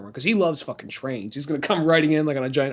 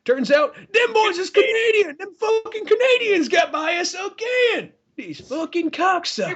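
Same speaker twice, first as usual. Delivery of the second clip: very high, hammed-up patriot rant; mock outrage; 13 semitones higher than usual. Patriot discovers the band is Canadian, mock outrage. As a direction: rage in a squealing put-on voice.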